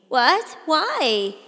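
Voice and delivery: sudden loud high "What? Why?"; jolted awake into alarm; startled protest. A narrator's reading. Teen startled by the police officer.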